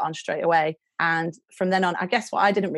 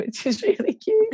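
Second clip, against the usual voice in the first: laughing through the words. Real, not acted. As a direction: giggle as you say it. Laughing while talking about plant.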